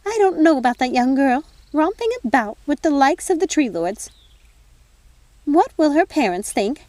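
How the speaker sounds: put-on tut-tut over the young girl's romping; prim fairy godmother.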